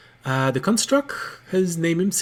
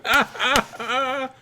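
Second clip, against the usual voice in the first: mock party laugh; 10 semitones higher, slower than usual. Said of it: "everyone else in your party go".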